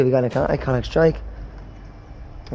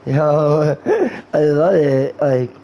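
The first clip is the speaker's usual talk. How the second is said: stoned guy. Pretend. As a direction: act high, dragging words and breaking into laughs. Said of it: "That's how you act when you're high".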